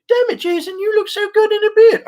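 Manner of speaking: swooning admirer. Pretend. Admirer teasing Jason about his beard in a falsetto. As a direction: swoon over him in falsetto.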